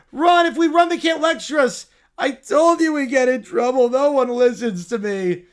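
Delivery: put-on panicked bratty kids. Kids scattering from security, urgent whine. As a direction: blurt it in a rush to flee.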